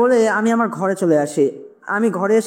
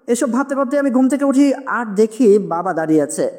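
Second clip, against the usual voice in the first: usual speaking voice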